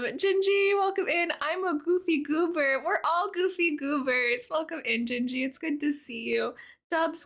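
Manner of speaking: normal talking voice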